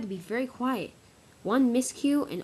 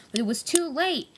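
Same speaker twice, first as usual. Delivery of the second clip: usual speaking voice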